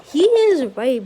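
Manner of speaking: teacher siding with Deku; firm declaration. Acted. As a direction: back him up with force.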